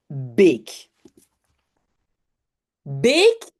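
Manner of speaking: "Bake" with a long pure é; "Bake" as Dutch beek, vowel lengthened; long closed E. Shown as a demonstration. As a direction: hold the é in "Bake", no glide. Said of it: "moi j'entends un E".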